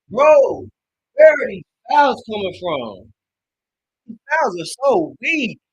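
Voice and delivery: loud, high, outraged yell at the refs; furious fan shouting at the refs; angry yelling. Real, not acted. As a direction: yell in real disbelief at the calls. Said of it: "I'm sorry to be yelling".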